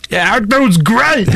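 high, stretched-out mock praise; hammed-up enthusiasm, slow and high. Acting out his drawn-out answer.

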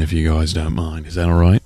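put-on low-pitched New Zealand accent. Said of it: "do the entire rest of the show in a New Zealand accent".

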